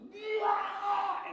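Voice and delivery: sudden high shrieking yell; demonic yell. Retold or imitated. Pastor on the front row suddenly shrieks a high demonic scream. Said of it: "all of a sudden goes".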